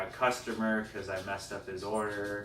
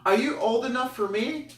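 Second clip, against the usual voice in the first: high, loud mock girl voice; 13 semitones higher, 5 dB louder than usual. Party girl sizing him up, high imitation. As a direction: ask it in a put-on high girl voice. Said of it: "I hear these two female".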